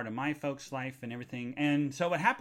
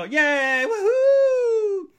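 high, loud gushing then woohoo; overflowing with joy; excited shout; 11 dB louder, much slower than usual. Real, not acted.

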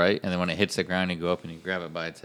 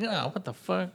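pitched-up shocked outburst, mostly acted; retold shock; 12 semitones higher, 5 dB quieter than usual. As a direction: throw your voice up high in mock disbelief. Retelling his shocked reaction.

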